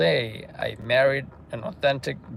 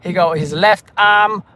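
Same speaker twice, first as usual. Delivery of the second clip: loud, higher radio-call delivery; police radio officer; 8 semitones higher, 11 dB louder than usual. Acted. Officer reporting a jumper to central, loud radio-call voice.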